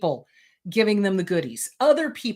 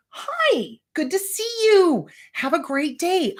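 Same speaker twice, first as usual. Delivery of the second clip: much higher, bright self-greeting; mirror greeting; 9 semitones higher, slower than usual. Shown as a demonstration. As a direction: greet your own reflection cheerfully. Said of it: "looking in the mirror".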